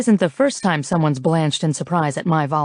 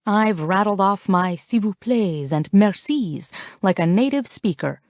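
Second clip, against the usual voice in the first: usual speaking voice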